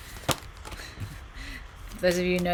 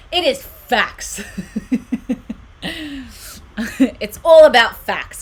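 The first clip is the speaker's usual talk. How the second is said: louder, higher, drawn-out and laughing; laughing exclamation; 5 semitones higher, 9 dB louder, slower than usual. Real, not acted. Streamer joking back at a viewer's comment, laughing exclamation. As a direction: proclaim it through laughter.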